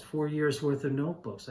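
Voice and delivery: usual speaking voice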